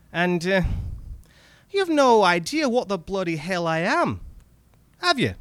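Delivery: high, excited Scottish goblin; wheedling little red cap creature voice. Acted. Goblin speaks in story.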